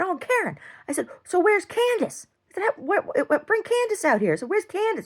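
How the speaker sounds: high, sing-song taunting questions; mocking taunt. Taunting the neighbor about Candace, high mocking taunt. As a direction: needle her sweetly.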